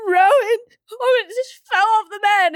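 high, loud, breaking with alarm; panicked cry. Retold or imitated. Mother wakes husband in tears, frantic. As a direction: blurt it out in a panic, near tears.

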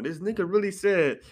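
ordinary talk

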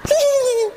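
high, thin giggle of disbelief; incredulous high-pitched titter; squealed laugh. Acted. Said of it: "así tal cual hice yo".

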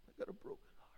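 whispered, grieving; hushed whisper. Acted. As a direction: breathe the words with sorrow. Jesus, then congregant, whispered.

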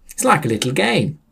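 chirpy high-pitched character; perky high voice; high cheerful Sam voice. Acted.

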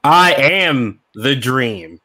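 slow, low dramatic wrestler boast; wrestler catchphrase. Acted. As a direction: drawl it like a pro wrestler.

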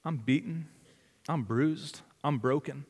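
hushed, humble prayer to God; quiet prayer. Pretend. Preacher voicing a beaten prisoner's soft prayer to God. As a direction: pray it softly, broken but trusting. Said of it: "Can you stop and be like".

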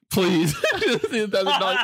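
high-pitched mock begging; pleading. Gambler begs his friend for a thousand dollars, mock pleading. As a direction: beg playfully, voice lifted. Acted plea for money.

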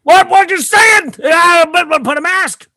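high, loud mock yelling; mock shout. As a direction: yell it in a silly high voice.

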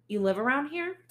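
high, bright questioning lift; eager question. Acted. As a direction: ask it up high, hopeful. Narrator questions teen witness.

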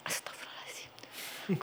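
slow, hushed whispered exclamation; shocked whisper. Retold or imitated. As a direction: breathe it out in awe. Woken at night, shocked. (bisikan kaget, pelan)

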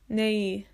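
Nei said as one gliding nay sound; demo sound. Shown as a demonstration. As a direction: say Nei as a slow glide. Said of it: "como un E con un I".

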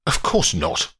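understated angry retort; a touch of indignation in the denial. A narrator's reading. Paddy denies involvement in the death to his lawyer, mildly angry. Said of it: "says Paddy angrily".

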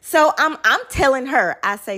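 normal talking voice

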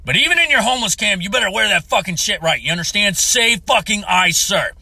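jokey parade-ground yell; mock drill-sergeant bark. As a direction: snap at the audience like a sergeant. Mock drill sergeant order to listener.

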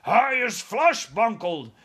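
high, loud, slow giant roar. Acted. Trussed giant wakes under helicopters, roaring loud and high. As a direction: roar it out like a tied-up monster. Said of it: "roared the flesh lump eater".

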